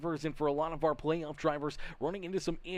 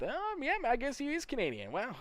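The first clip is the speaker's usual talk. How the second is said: much higher pitched, conceding; surprised concession; 12 semitones higher than usual. Real, not acted. Host finds Horvath is Canadian, surprised concession. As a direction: climb high as you admit the mistake. Finds he was wrong on nationality.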